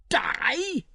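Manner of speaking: high startled witch shriek; a sudden high fearful cry; shrieked in terror. A narrator's reading. Witch hears she will die, high shriek. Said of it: "she shrieked in terror and fury".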